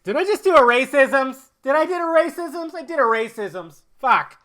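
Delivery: high, loud silly self-reproach; mock panic. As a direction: squeal it in hammed-up dismay. Mock panic at his own mistake.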